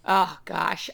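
usual speaking voice

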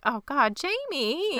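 high, drawn-out pitying cry; heartbroken. Retold or imitated. Fan watching the player bullied by dad, pitying wail. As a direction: let the name rise and stretch in sympathy. Heartbroken reaction to retold scene.

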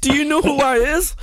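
high, boastful put-on voice; mock superstar brag. Imitating [name] boasting.

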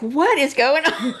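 high, amused imitation of bewilderment; exaggerated confused question; mock bafflement. Voicing Eric's baffled reaction.

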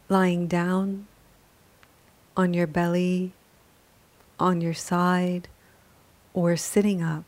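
calm, measured yoga nidra guidance; unhurried, even-toned relaxation voice; guided meditation. Acted. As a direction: guide a resting listener gently, with long pauses between phrases.

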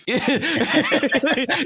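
a sudden high-pitched laugh that breaks out; high burst of laughter. Real, not acted. Laughing at his own reply to his wife, high and bursting. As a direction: let a real laugh spill out high.

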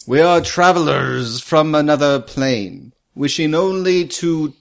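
slow, measured, formal address; formal, slow paladin. Acted. Paladin explaining himself to the creature ignoring him, slow and formal. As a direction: spell it out slowly and grandly.

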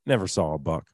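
normal talking voice

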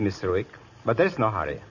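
normal talking voice

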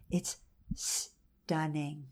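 very slow and hushed, STUNNING drawn right out; stretched-out, quiet STUNNING in real wonder; awed emphasis. Real, not acted. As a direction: linger on STUNNING, softly, with a pause before it. Repeats it slowly for emphatic awe.

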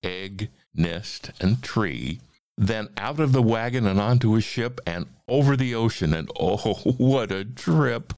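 animated rhyming storybook reading; story narration. A narrator's reading. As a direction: read the verse with big, playful swings. Reads a rhyming story aloud.

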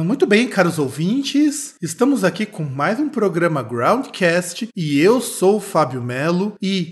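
loud, slow radio host opening; announcer voice. Acted. Hosts the show opening.

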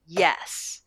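loud, emphatic cheer; re-enacted excited shout. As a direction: relive cheering at the screen. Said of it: "I started clapping and shouting".

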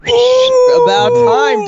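teasing drawl. Real, not acted. Teasing a friend's late viewing.

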